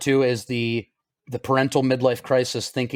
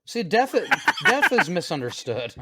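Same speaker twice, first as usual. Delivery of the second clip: mock profound; 6 semitones higher, slower than usual. Joking answer to a dark remark.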